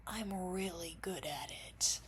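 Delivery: soft, slow, whispery aside; whispered boast. Real, not acted. Vlogger on saying the same thing repeatedly, slow whispered boast.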